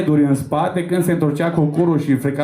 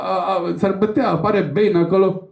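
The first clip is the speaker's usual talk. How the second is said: ordinary delivery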